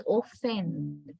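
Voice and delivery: OFFEND wrongly begun with a full, clear O; wrong full vowel. Shown as a demonstration. Teacher showing students how not to say offend, full-vowel mispronunciation. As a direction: say OFFEND with an unreduced first vowel. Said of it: "we don't say them as".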